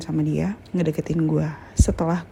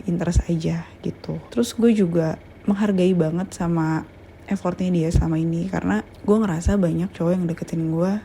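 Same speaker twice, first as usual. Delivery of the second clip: ordinary delivery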